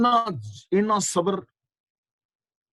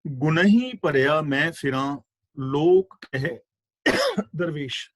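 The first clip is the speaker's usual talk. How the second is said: drawn-out, louder chanting of the verse; slow recitation; 4 dB louder, slower than usual. Acted. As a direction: recite the shloka slowly and deliberately. Recites Farid shloka. (ਹੌਲੀ ਹੌਲੀ ਸ਼ਲੋਕ ਦਾ ਪਾਠ)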